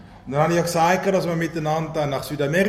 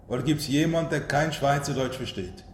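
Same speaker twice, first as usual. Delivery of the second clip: Standard German; 3 semitones lower, 5 dB quieter than usual. Real, not acted. Preacher looks for non-dialect speakers, in Standard German. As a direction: ask in Hochdeutsch, not dialect. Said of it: "stelle ich um auf Hochdeutsch".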